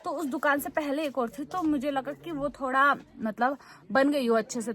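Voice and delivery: ordinary delivery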